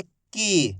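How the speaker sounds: "की" taking extra time on the ee; long vowel. Shown as a demonstration. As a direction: linger on the vowel of "की". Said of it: "यानी कि ज़्यादा समय लग रहा है". ("की" में ई पर ज़्यादा समय)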